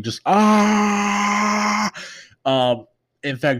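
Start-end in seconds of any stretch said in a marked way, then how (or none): 0.3-1.9 long, high held "ah" scream; mock anime scream. Reviewer showing how Dragon Ball characters scream, mock scream. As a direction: imitate a drawn-out cartoon battle scream. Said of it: "do nothing but just scream".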